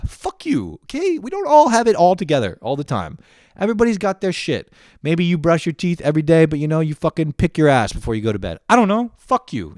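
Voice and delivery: high, loud defensive rant; heated rant. Real, not acted. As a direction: defend yourself, loud and fired up.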